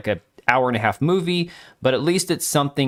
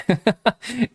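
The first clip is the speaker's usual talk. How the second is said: short burst of real laughter; 9 semitones higher than usual. Real, not acted. Streamer amused by his own coming analogy, laughing. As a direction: crack up mid-thought.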